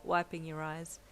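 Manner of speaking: ordinary delivery, nothing marked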